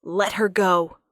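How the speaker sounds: loud snarled command; king ordering Thorn to release her. Acted. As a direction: bark the order with alpha power. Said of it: "he snarls".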